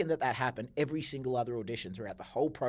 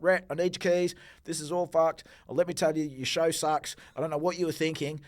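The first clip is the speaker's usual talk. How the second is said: raised, higher voice, re-enacting a tirade; heated re-enacted outburst; retold angry rant; 6 semitones higher, 8 dB louder than usual. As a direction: tell them off loudly as you relive it.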